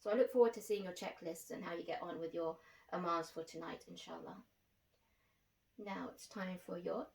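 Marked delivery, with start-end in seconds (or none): none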